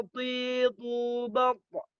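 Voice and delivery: normal talking voice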